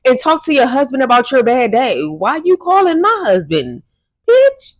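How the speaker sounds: high-pitched, outraged clapback; indignant telling-off. Acted. Daughter telling mother off over husband, indignant.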